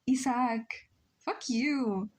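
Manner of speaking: ordinary talk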